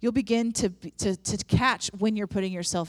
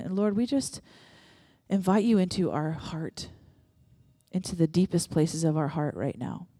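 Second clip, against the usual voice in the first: congregational prayer. Acted. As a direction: pray over the room, unhurried. Leads the room in prayer.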